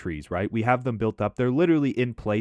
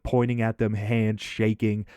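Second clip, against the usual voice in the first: ordinary delivery, nothing marked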